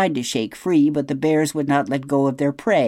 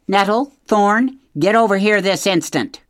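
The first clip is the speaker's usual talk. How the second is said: higher and louder, a mother bear calling her cubs; stern mother call; 7 semitones higher, 5 dB louder than usual. A narrator's reading. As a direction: call them back sharply like an angry mother.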